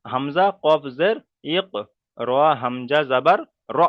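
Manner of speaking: হামজা কফ জের ইক y র হামজা জাবার র, slow with the sakin letters jolted; qalqala demo. Shown as a demonstration. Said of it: "কেমন করে পড়তে হবে দেখেন".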